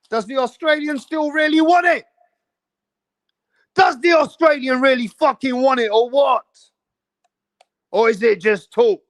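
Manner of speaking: high, jeering call-out that swells to a shout; taunting. Real, not acted. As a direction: goad the rival fans, daring them to answer.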